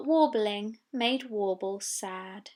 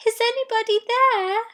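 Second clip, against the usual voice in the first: sorrowful call; 12 semitones higher, 7 dB louder than usual. Acted. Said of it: "she called out sorrowfully".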